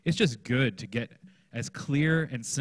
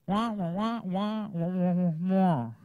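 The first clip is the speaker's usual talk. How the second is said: high muted trombone wah-wah, slower at the end; Charlie Brown's teacher; 8 semitones higher than usual. Retold or imitated. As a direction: mimic a muffled horn droning nonsense. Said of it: "they just heard Charlie Brown's teacher".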